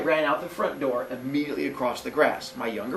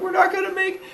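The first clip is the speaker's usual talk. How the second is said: very high falsetto squeal; mock kid panic. Boy being chased beside his brother, high mock-panicked squeal. Losing the race, acted.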